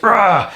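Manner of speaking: high mock roar of rage; imitated roar. Man losing his temper at his tormentor, mock roar. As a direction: let out a short raging "rah". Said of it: "I was completely just".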